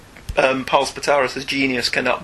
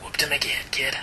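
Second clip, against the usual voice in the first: softer, lower gunslinger line; low quiet Western growl; 9 dB quieter than usual. Pretend. Host redoes the Josey Wales line low and quiet. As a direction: say it low like a weary outlaw. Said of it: "play at Josie Wales".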